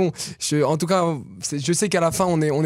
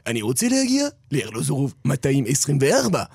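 Hebrew line in a smooth radio announcer voice, first word pitched up; polished Hebrew in a radio-style voice; 3 semitones lower than usual. Shown as a demonstration. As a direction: say it like a radio presenter to hide your accent. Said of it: "la voix que tu utilises ... à la radio".

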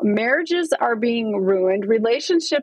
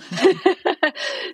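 light laugh; 6 semitones higher than usual. Real, not acted. Laughing at the host's joke.

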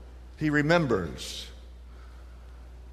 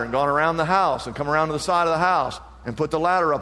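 usual speaking voice

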